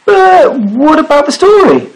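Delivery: high, animated sailor's question; dismayed protest. A narrator's reading. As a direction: protest the interrupted story.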